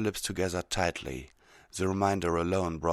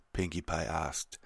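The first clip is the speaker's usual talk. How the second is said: plain reading voice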